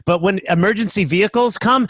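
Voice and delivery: usual speaking voice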